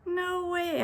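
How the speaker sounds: high, whiny protest; complaining. Acted. Boy protesting to Dad about the meeting, high whiny complaint. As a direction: complain like a kid refusing. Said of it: "I complained".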